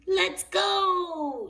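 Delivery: high, loud cheerful shout. A narrator's reading. Daddy Pig starts the drive with a loud cheery shout. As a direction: call everyone off on the trip. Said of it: "shouted Daddy Pig".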